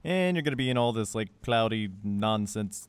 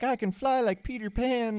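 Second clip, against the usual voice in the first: high, drawn-out silly boast; mock-excited, stretched-out and high; giddy mock boast; 10 semitones higher than usual. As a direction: play it giddy and deluded, sing-songy. Mock quote of dazed player.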